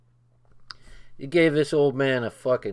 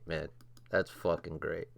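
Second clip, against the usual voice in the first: ordinary talk, nothing marked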